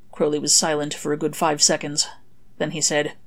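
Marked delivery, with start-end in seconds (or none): none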